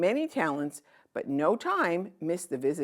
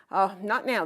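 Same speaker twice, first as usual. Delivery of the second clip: brushing off, a bit higher; distracted sister; 6 semitones higher, 5 dB louder than usual. Painter sister dismissing the girl, distracted. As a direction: say it without looking up. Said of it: "responded with eyes on her palette".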